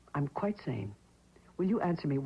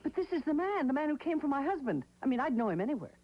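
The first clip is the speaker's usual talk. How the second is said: agitated insistence; 8 semitones higher than usual. Acted. Widow insists it was him.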